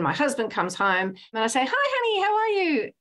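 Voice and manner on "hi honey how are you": much higher, bright bubbly hello; 夫を迎える明るく弾む高い声; bubbly greeting. Retold or imitated. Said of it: "bubbly, bouncy".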